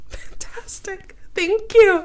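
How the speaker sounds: high, tiny put-on cheer; mock delight. Reacting to finding her lost keys, mock delight. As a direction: squeeze out a high, playful thanks.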